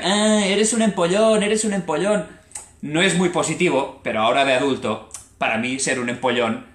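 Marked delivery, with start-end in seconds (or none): 0.0-2.3 higher, louder mock teasing of the swot; sing-song it like a playground jeer; imitating a childish taunt. Retelling how classmates mocked him, in a higher, louder teasing voice. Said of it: "cuando yo era pequeño me decían".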